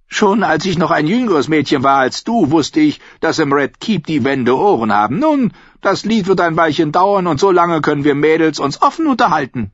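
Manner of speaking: put-on high, forceful old-woman voice; wily old lady. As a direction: talk like a canny, bossy grandmother. Old lady confides to girl.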